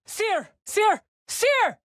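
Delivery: high, urgent call of her name; rising desperate calls across the field. Acted. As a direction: cry out to find her. Calling out to Cere in battle.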